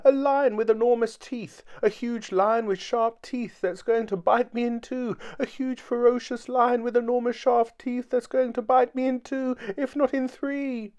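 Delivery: very high, loud panicked squealing; terrified shriek. A narrator's reading. Mister Jelly mistakes a snore for a lion, shrieking in panic. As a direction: squeal in mounting terror, like a tiny hysterical character. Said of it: "shrieked Mister Jelly".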